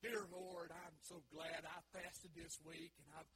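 loud, self-satisfied prayer; hammed-up pious boasting; smug Pharisee. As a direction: pray like a show-off, slowly. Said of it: "He gets up there and he says".

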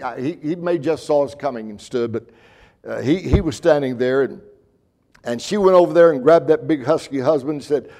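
ordinary delivery

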